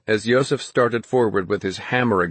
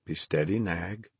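calmly; 2 semitones lower, 10 dB quieter than usual. A narrator's reading. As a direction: hush the hurt mare gently. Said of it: "the horse doctor said calmly".

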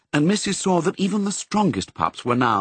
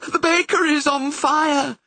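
a gasping, much higher alarmed cry; gasped; 3 dB louder than usual. A narrator's reading. The red setter bursting out the news of the fire, gasping. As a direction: blurt the news out breathless with alarm. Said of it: "she gasped".

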